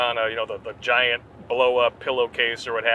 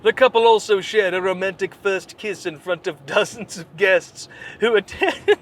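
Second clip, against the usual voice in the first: mock news read; 6 semitones higher than usual. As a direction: read the gossip like a breathless reporter. Reads article in mock voice.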